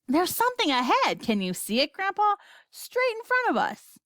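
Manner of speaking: high-pitched, urgent sighting; excited boy. A narrator's reading. Charlie pointing something out to Grandpa, excited. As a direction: call it out eagerly, pitch jumping up.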